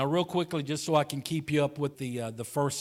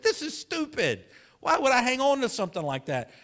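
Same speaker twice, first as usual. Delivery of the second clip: exasperated self-rebuke; 11 semitones higher, 4 dB louder than usual. Retold or imitated. Retold words to himself.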